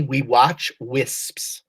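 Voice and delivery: We watch wisps said slowly word by word; feed the line slowly to the reader; slow prompt. Shown as a demonstration. Teacher slowly prompting the student's next line. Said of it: "right here on the bottom paragraph".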